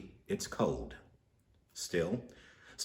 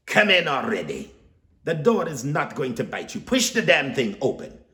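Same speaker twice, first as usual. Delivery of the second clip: loud, higher, impatient order; loud command; 6 semitones higher, 7 dB louder than usual. Acted. Psychic ordering Stanley through her door, loud and impatient. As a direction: boss him in through the door. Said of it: "the lady commands".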